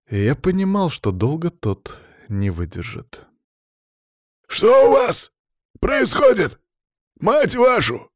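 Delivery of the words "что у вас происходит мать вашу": furious high, loud roar; angry boss bellowing over a bad connection. A narrator's reading. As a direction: roar it in broken bursts. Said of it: "рычал Палыч".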